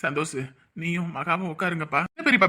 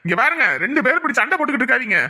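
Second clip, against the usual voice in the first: raised, louder voice, rushing to intervene; urgent remonstrating; 4 semitones higher, 5 dB louder, faster than usual. Acted. Man stopping two quarrelling women, urgent raised voice. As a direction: call out urgently, higher and loud.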